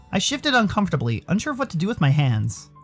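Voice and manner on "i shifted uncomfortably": ordinary delivery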